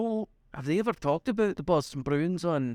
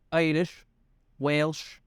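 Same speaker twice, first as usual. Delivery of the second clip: normal talking voice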